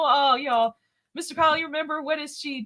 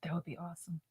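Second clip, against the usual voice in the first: soft unvoiced whisper; 7 semitones lower, 16 dB quieter than usual. Real, not acted. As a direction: breathe it as a whisper. Whispers reply.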